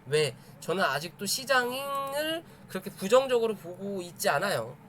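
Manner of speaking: usual speaking voice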